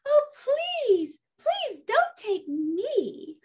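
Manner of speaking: high, pleading tiny goat; little goat begging slowly, high-pitched; little billy goat. Acted. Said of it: "said the little billy goat".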